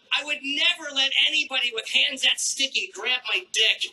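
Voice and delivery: ordinary delivery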